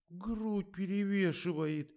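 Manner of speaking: very high, slow character whine; sulky snuffling. A narrator's reading. Katya excuses her fall, sulky high whine. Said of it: "засопила Катя".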